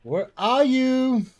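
high, drawn-out call. Real, not acted. Player calls for a hidden enemy, calling out. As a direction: stretch it out, searching.

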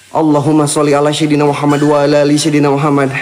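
low, fast prayer under the breath; fast muttered prayer. Real, not acted. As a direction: rattle off the sholawat quickly and low. Mutters a prayer after scare. (doa cepat bergumam pelan)

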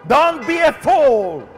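forceful warning, hammering the key words; fiery preaching. Real, not acted. Preacher warns listeners of coming judgment, fiery preaching. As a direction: preach judgment with long pauses between cries.

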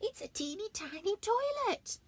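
high, loud tiny-toilet squeak; squeaky bear. Acted. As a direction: exaggerate teeny tiny. Bear describing dolly toilet.

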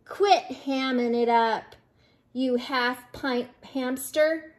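usual speaking voice

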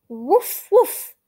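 loud high yappy puppy woof; puppy barks. Acted. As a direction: bark like an eager little puppy. Voicing the puppy Biscuit.

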